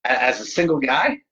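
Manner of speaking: normal talking voice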